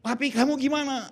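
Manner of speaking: high-pitched worried-wife imitation; wife's voice. Wife asking husband about himself, high imitated voice. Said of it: "istri saya bilang gini".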